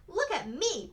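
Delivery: put-on high, loud child's cry for attention; excited cry. A narrator's reading. Peg showing off her new dress, crying out high. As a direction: call out proudly, pitch leaping up. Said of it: "Peg cried".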